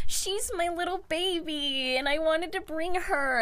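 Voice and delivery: put-on high, babyish voice; pleading pet owner. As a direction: plead like a kid defending a pet. Acting Aaron pleading for his pet.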